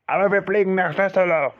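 high, loud, strange voice; hijacker outburst. Acted. Hijacker insisting to the hostage, loud. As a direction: snap it as the angry hijacker.

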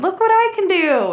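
high, loud, chirpy show-off; showy announcement. Retold or imitated. Woman showing the cashier her headstand, high and loud and chirpy. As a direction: announce the trick like a proud kid. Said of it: "walked over by the counter and said".

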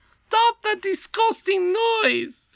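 very high, slow, loud squeaky cry; valiant goblin prince. A narrator's reading. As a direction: bravely squeal the order. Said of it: "cried the Crown Prince valiantly".

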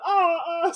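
short high-pitched mock screams. Retelling the bystanders' fake panic, mock screams. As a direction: imitate everyone screaming in panic. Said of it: "starts screaming".

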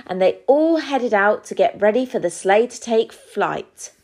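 ordinary delivery, nothing marked

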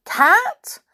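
high, loud startled query; anxious. A narrator's reading. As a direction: mishear it with alarm. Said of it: "looked at him anxiously".

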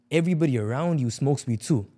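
normal talking voice

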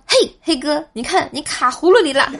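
high, playful mock-villain gloat; gleeful play-acted gotcha; teasing taunt. Continuing the teasing gourd game.

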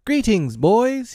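high, ringing greeting; hearty cry. A narrator's reading. Peter greeting his boys on return, high cry. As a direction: hail your gang high and eager. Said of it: "he cried".

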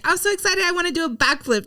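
bubbly and high, fast; bursting with excitement; excited. Retold or imitated. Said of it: "you're like".

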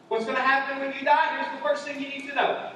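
usual speaking voice

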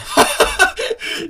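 breathy high embarrassed laugh. Real, not acted. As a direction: laugh off the slip. Laughing at own voice crack.

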